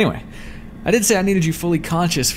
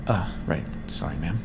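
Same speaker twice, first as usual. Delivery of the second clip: low, subdued and softer; chastened apology; 9 semitones lower, 7 dB quieter than usual. Acted. Servant apologizing to the master after rambling, chastened. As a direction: back down meekly, voice dropped.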